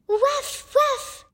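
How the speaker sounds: put-on high child voice going waf waf; toddler barking. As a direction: play a small child barking like a dog. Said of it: "s'il dit".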